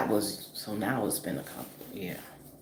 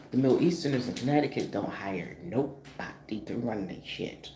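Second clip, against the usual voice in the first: ordinary talk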